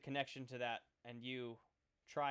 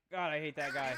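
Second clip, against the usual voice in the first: amused laugh; 7 dB louder than usual. Real, not acted. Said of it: "he says".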